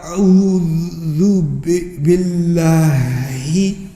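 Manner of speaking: slow halting "أعوذ بالله" stumbled out; stumbling recitation. Shown as a demonstration. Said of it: "Like that".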